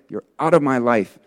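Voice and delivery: firm demonstration of casting something out; tenacious rebuke. Shown as a demonstration. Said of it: "with tenacity towards it".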